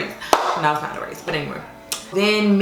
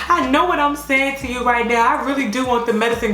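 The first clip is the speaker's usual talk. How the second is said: higher, urgent pleading for the epidural; 5 semitones higher than usual. Retold or imitated. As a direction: beg him with pitch raised. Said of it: "I'm pleading my case".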